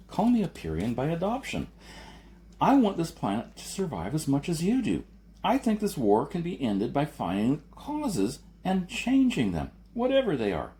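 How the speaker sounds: earnest debater growing impassioned; Jason, rising to heated pleading. A narrator's reading. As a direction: press the argument harder and harder. Narrator voices Jason.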